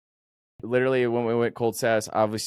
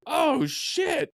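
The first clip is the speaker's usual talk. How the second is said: startled. Retold or imitated. Jolted awake by yelling.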